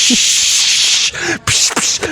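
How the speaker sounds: breathy psh of a fart; fart noise. Pretend. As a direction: blow out a fart sound. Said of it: "like, psh".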